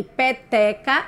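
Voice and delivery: "Peteca" slowly sounded out; slow, measured "Peteca" with the end lifted; syllable by syllable. Shown as a demonstration. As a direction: stretch each syllable of "Peteca" for a class. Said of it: "A sílaba do meio".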